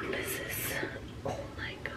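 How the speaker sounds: real stunned whisper; horrified whisper. Real, not acted. Prankster staring at stained hands, horrified whisper. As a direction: whisper it to yourself in dismay.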